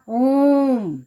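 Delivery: ओम held long on the ओ; prolonged vowel. Shown as a demonstration. Teacher demonstrates the three-beat vowel for pupils, prolonged ओम. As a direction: stretch ओम out in one sustained swell. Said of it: "तीन मात्राओं का समय लगता है".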